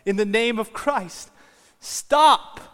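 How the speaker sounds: very loud, high shout, peaking on the last word; full-force yell. Retold or imitated. Monk on the barrier yells to the gladiators, yelling. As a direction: bellow a command over the whole arena. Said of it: "jumps on the barrier ... and he yells".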